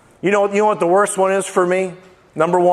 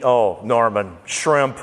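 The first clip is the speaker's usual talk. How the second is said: ordinary talk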